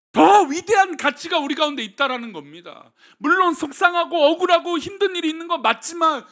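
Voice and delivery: loud, high, urgent sermon delivery; full-force preaching. Acted. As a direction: preach it hard to the congregation. (힘차게 외치는 설교)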